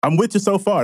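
normal talking voice

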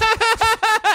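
shrieking laugh; high laugh. Real, not acted. Laughs at cheese line.

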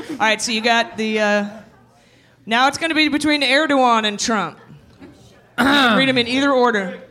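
ordinary talk

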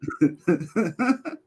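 quiet high giggle; soft high giggle. Real, not acted. Chuckles at his co-host's taxi joke, soft giggle. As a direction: let a soft giggle slip.

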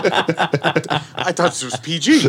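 slow, laughing mock objection; laughing at the joke; laughing protest. Real, not acted. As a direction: pretend to be shocked while laughing.

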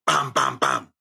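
three low verbal jabs; low punchy imitation. Man showing his wit at work, low and punchy. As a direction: punch out each hit deep in the voice.

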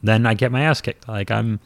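ordinary talk, nothing marked

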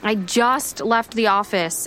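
ordinary delivery

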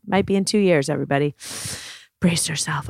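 normal talking voice

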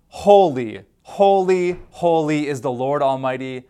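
proclaimed loud and ringing; angels shouting. Retold or imitated. Preacher voicing angels calling to one another, loud proclamation. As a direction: call out holy like the angels. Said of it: "the angels shouted praising".

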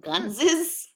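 "Guns is" run together as "Gunses", questioning; echoed mispronunciation. Retold or imitated. Storyteller echoing the running joke, "Guns is" as "Gunses". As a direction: softly echo it as "Gunses". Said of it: "who pluralizes guns?".

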